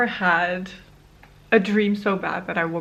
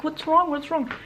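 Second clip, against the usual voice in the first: higher-pitched, startled imitation of husband; quick mock alarm, pitched up; 7 semitones higher than usual. Husband waking to a crying partner at night, retold as startled. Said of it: "startled because I'm crying".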